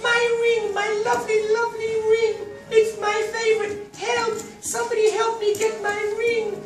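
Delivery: very high, loud wailing princess; fluttering cries for help. Acted. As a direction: wail like a spoiled princess. Princess wailing over lost ring.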